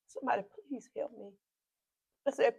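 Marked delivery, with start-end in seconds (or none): none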